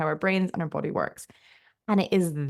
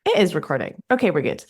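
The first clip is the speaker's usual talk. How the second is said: ordinary talk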